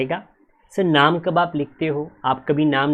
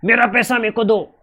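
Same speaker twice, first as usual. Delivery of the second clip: higher mock demand for money, barely louder; mock yell; 7 semitones higher, 3 dB louder than usual. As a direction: act out an angry creditor's shout, lightly. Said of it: "चिल्लाते हुए". (हल्की नकली चिल्लाहट)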